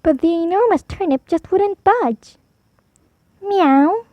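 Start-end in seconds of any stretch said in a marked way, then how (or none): 3.4-4.1 1 meow. Acted. Cat meows in the story.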